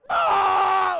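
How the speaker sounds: high, very loud shriek; kid's scream. Retold or imitated. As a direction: let out a sudden shriek on the first drop. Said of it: "he starts screaming".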